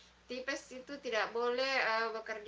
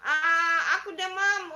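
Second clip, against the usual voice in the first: higher, louder, whiny mock sob; mock crying complaint; 5 semitones higher, 10 dB louder, slower than usual. Host voices a feverish viewer, hammed-up crying whine. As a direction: act out a sick child's whimper.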